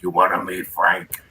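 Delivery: a gruff nightclub owner's offer, brief and loud; quick imitation of the Copa boss offering an introduction; Jules Padell impression. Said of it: "Jules Padell talked like this".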